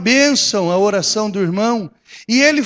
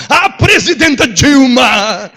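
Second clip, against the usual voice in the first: louder, slow pompous announcer; pompous announcement; 3 semitones higher, 8 dB louder, slower than usual. Retold or imitated. Pastor mocking reporters on Dilma, pompous loud announcing. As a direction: proclaim it with mock grandeur. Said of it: "Aí vai falar da Dilma".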